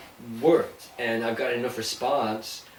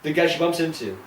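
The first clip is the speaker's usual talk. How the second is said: normal talking voice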